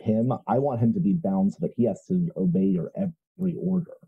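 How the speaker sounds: ordinary delivery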